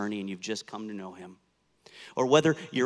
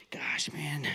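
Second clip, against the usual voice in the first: whispered under the breath; whispered aside; 6 dB quieter than usual. Acted. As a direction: let it slip out as a whisper. Peter, ashamed, talks to Jesus.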